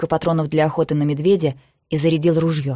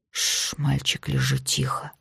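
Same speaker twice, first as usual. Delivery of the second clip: soft, whispery shushing; quiet, low, soothing order; hushed command; 8 dB quieter, slower than usual. A narrator's reading. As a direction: hush a dog with a low murmur. Hushing his dog to lie quiet.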